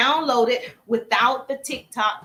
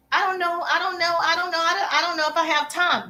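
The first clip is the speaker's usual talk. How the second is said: higher-pitched mock dithering; mimicked excuse-making; 4 semitones higher than usual. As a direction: imitate people making repeated excuses. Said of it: "people are like".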